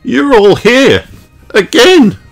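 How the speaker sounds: loud, high and drawn out; startled surprise. A narrator's reading. Host facing visitors who turned up again, loudly startled. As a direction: exclaim it in high-pitched disbelief. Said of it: "gasped Mister Kangaroo".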